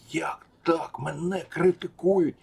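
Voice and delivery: high, drawn-out mock disbelief; slow, high-pitched imitation of shock; imitated astonishment. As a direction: play the affronted bigwig, utterly taken aback. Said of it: "була повна несподіванка".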